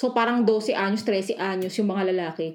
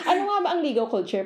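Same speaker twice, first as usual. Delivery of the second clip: ordinary talk, nothing marked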